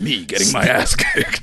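slow dramatic trailer line; movie trailer announcer. Pretend. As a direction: drag it out like a teaser. Trailer voice bit.

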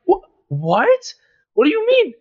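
high-pitched, disbelieving retort; incredulous. Retold or imitated. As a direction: act out being taken aback by a taunt. Acted baffled reply to taunting friend.

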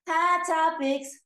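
loud, slow segment announcement; announcer voice. Acted. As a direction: announce it like a show jingle. Announces segment jingle-style.

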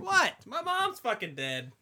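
high, loud, drawn-out protest; indignant outburst. Real, not acted. Groom defending crying over his dead mom, loud high indignation. Protests being called a pussy.

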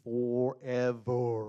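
very slow stretched word; drawn out. Shown as a demonstration. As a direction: stretch Forever out endlessly. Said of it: "coupled with this one".

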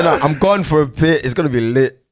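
ordinary talk, nothing marked

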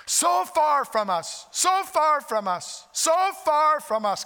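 high, loud, slow repetition; preaching emphasis. Acted. Preacher stressing God's distance, loud and slow. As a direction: lean hard into each so far.

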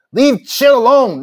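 furious slow shout; angry shout. Real, not acted. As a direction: bellow it at him. Angry rant telling [name] off.

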